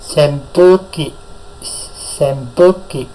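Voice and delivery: Senpuki drawn out slowly, each syllable clear; slow model word. Shown as a demonstration. Teacher modelling vocabulary for the class, slowly. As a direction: stretch Senpuki out slowly for learners. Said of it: "saya ucapkan satu kali diikuti".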